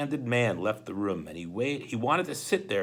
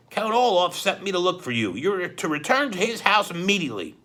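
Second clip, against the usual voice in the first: louder, bossy henchman; gruff henchman voice; 7 semitones higher, 5 dB louder than usual. Acted. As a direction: order him home coldly. Henchman gives orders.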